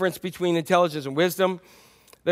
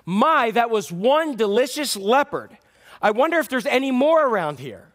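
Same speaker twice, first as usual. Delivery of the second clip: loud boast; 7 semitones higher, 4 dB louder than usual. Acted. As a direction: boast loudly so the leopard overhears. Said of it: "the dog said very loudly".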